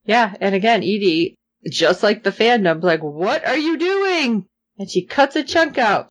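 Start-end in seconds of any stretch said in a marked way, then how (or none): 3.0-4.5 drawn-out, high-pitched mock dismay; exasperated disbelief. Co-host voices the fandom reacting to Zoe, exasperated. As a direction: stretch it out slowly in high disbelief.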